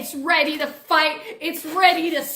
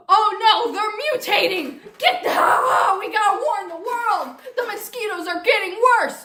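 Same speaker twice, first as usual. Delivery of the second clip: higher, louder, rushing alarm; mock panic; 4 semitones higher, 3 dB louder than usual. Narrator warns the world, hammed-up panic shout. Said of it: "own building panic, shouted".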